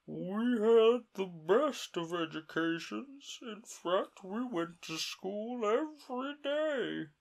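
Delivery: slow, high, mournful turtle voice; a weepy old turtle settling in to tell his tale; mock turtle voice. The Mock Turtle to Alice, slow and high.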